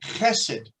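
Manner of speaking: chesed with a throaty ch at the start; slow, careful Hebrew word chesed; Hebrew pronunciation. Shown as a demonstration. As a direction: say chesed, ch scraped in the throat, stress first syllable. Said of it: "is pronounced chesed".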